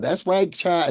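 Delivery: hammed-up Southern mammy drawl, light touch; mocking mammy voice. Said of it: "Don't you hear the mammy effect".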